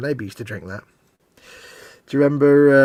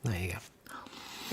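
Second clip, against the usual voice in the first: whispered aside; 6 semitones lower, 13 dB quieter, much slower than usual. Real, not acted. Quiet aside after remembering.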